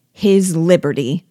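the planter lashing out at his nephew; savage. A narrator's reading. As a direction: spit the words out in fury. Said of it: "replied he savagely".

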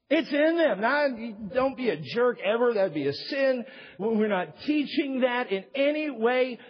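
fervent sermon build; preaching. Acted. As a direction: press the congregation hard. Preaching at full force.

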